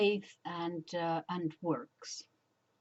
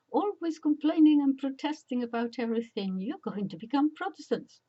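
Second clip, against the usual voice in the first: higher, louder mock scold; scolding old nun; 6 semitones higher, 6 dB louder than usual. Old nun scolding complaining children, higher and louder. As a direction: play the exasperated old nun. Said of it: "this is an old nun".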